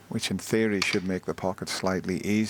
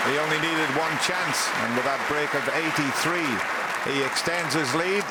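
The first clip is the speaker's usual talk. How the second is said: raised, higher commentator wrap-up; excited commentary; 8 semitones higher, 4 dB louder than usual. Real, not acted. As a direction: lift it for the frame-winning moment. Frame won, commentator sums up.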